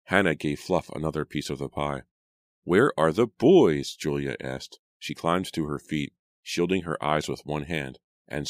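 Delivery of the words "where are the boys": slow, loud, high question; calling question. A narrator's reading. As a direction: ask it raised and drawn out, searching. Sister looks for missing boys.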